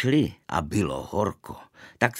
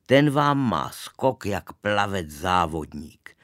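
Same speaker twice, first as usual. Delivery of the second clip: ordinary delivery, nothing marked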